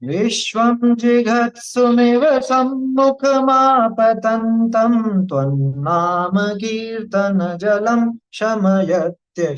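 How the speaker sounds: slow chanted Sanskrit shloka, each syllable drawn out; slow verse recitation. Shown as a demonstration. Teacher chanting the shloka for the class, slow and measured. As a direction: intone the verse slowly and reverently.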